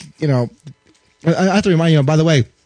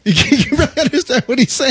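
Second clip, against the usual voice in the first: laughing through the words; can barely get it out for laughing; 8 semitones higher than usual. Real, not acted.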